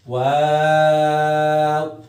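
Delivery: teacher models a long waw; elongated letter name. Shown as a demonstration. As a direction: stretch वाव long with round lips. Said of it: "तीन से पाँच अलिफ के बराबर".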